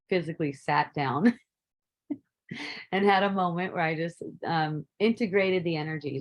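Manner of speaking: ordinary delivery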